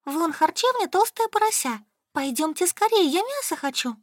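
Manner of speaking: high-pitched, craving character voice; hungry eagerness. A narrator's reading. Solka pulls her friends toward an eatery, hungrily eager. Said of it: "глотая слюни".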